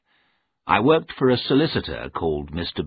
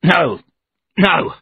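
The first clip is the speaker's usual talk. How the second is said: loud, higher frightened refusal; panicked loud refusal; shouting; 11 semitones higher, 8 dB louder than usual. A narrator's reading. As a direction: cry out no in alarm. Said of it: "I shouted".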